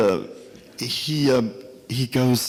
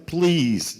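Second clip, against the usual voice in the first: retold pleading; 7 semitones higher than usual. Hungry traveler begging a taxi driver for a ride, pleading. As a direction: beg with a high, falling please.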